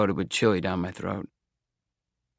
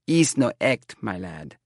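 put-on indignant seer with a thick Romany accent; slower than usual. A narrator's reading. Seer corrects young man about her act, Romany accent. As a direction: bend the vowels in a stagy Romany accent. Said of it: "act for act".

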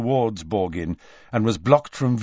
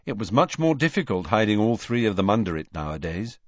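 plain reading voice

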